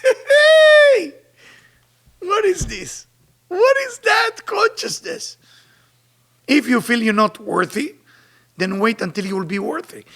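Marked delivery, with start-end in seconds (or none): 0.0-1.1 shout hey. Real, not acted. Outraged shout. 2.2-5.4 indignant. Real, not acted. Indignant questioning.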